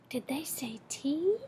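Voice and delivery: conspiratorial whisper aside. Real, not acted. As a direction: whisper it like a secret. Aside to young listeners.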